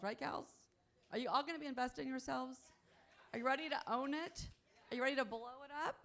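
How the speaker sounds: loud, high pep-rally questions; rallying the crowd. Real, not acted. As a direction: fire up the room, demanding a yes. Speaker rallying the audience with questions.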